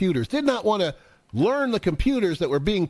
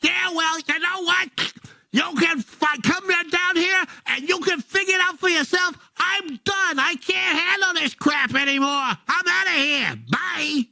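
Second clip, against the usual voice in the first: fed-up driver quitting; 9 semitones higher than usual. Acted. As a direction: blow up and quit the job. Driver quits the call angrily.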